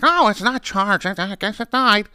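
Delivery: put-on high, slow, deflated old woman; high slow helpless sigh; clueless clerk. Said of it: "She's like".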